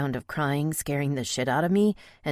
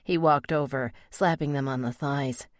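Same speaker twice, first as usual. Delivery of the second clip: plain reading voice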